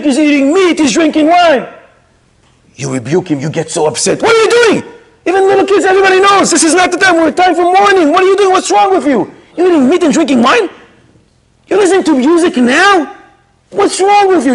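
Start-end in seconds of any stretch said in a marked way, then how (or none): 4.2-6.9 mock rebuke. Scolding someone dancing during mourning. 8.1-14.5 mock rebuke. Scolding someone dancing during mourning.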